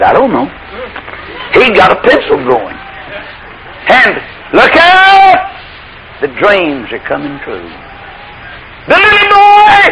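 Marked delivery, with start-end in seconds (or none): 3.9-5.5 high, drawn-out cry of look out; stretched high preacher's shout; excited call. Real, not acted. Preacher calling out climax. 8.9-9.8 high ringing preacher call; rising excitement. Real, not acted.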